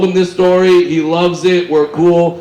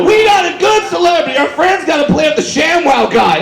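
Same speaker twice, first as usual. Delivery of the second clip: excited shout; 11 semitones higher than usual. Retold or imitated. Golfers gloating over their celebrity, high excited shout. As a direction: crow it like fans who won big. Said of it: "and they're like".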